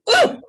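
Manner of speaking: sudden high, loud wail; ghostly wail. Retold or imitated. Cousins hear the wail again.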